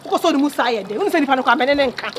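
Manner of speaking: ordinary talk, nothing marked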